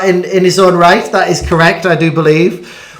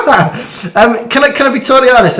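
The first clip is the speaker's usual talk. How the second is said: laughing; 8 semitones higher than usual. Real, not acted. Host laughs at joke.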